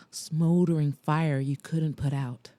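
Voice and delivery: ordinary delivery, nothing marked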